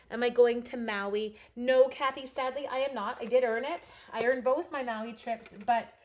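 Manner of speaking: usual speaking voice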